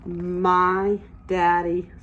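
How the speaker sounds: ordinary talk, nothing marked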